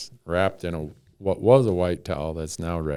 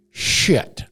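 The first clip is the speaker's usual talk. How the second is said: sharp whispered curse, higher; 8 semitones higher, 5 dB louder than usual. Retold or imitated. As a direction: hiss it in sudden dismay. Sudden realisation after passing out.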